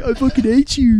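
put-on high, loud go at a Swedish accent; mock Swedish accent. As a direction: retell it in a badly faked Swedish accent. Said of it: "in the Swedish accent".

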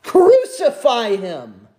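loud, drawn-out cry of condemnation; mob shout. Acted. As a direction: shout it slowly like a crowd turned hostile. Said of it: "Those shouts turn into a".

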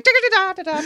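high hummed musical flourish; sung flourish. Retold or imitated. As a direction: sing a little tag. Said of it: "he does his thing".

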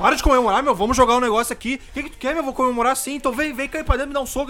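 high, loud, heated squabbling; hammed-up outrage over a game; mock angry quarrel. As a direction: act out two friends picking a fight. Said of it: "o outro já ficava bravo".

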